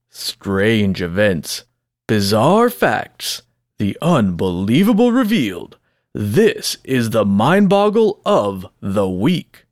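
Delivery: drawn-out segment-intro announcer read; slow announcer voice. Acted. Host introducing the weekly segment to listeners, slow dramatic announcer voice. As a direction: stretch each title out like a game-show host.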